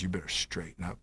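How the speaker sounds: imitating his coach's grumble; grumbling coach voice. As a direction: growl it quietly like a gruff coach. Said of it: "he did it in his grumbling voice".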